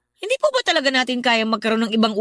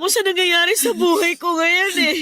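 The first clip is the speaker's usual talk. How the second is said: breaking down crying; 9 semitones higher than usual. Acted. Son sobbing to his mother about his heavy burden. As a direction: voice cracking into sobs, pitch climbing.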